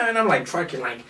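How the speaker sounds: normal talking voice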